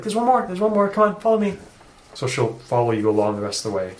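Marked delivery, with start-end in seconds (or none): 0.0-1.6 coaxing. Retold or imitated.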